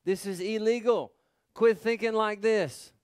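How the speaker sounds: a preacher voicing Jesus sternly; stern rebuke. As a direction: rebuke the worry with authority. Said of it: "Jesus said".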